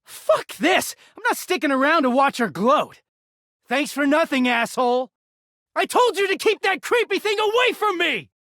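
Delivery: high furious parting shout; angry yelling. Acted. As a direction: yell it over your shoulder while storming out. Storming out while yelling.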